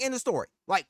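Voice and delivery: ordinary talk, nothing marked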